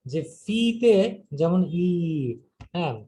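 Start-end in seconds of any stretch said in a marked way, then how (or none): 1.9-1.9 "ই" held as a long ee; kasra sound. Shown as a demonstration. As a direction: draw "ই" out to show the kasra vowel. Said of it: "কাসরার উচ্চারণ বা জেরের উচ্চারণ".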